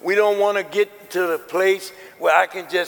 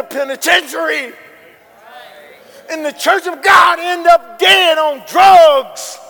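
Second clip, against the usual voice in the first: much higher and louder preacher's shout; full-force pulpit shout; preaching; 9 semitones higher, 8 dB louder than usual. Acted. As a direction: cry out the warning to the youth.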